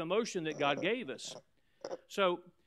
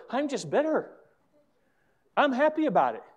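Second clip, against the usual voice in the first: much higher, happy-sounding imitation; mock cheerful; 8 semitones higher, 6 dB louder than usual. Pastor showing how bitter people never sound, cheerfully high. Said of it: "It's never".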